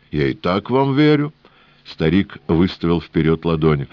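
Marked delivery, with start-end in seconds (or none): 0.1-1.3 put-on high, sing-song Odessa-style old man. A narrator's reading. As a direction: play the cunning old trader, lilting.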